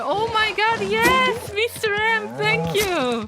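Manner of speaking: high, delighted thanks; thrilled. Real, not acted. Streamer thanking a chat helper, thrilled. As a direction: gush with excitement.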